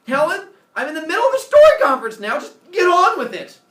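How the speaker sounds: loud, high, fast angry yell; angry shout. Acted. Husband shouting at his wife to get on with it. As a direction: cut her off, fed up and loud.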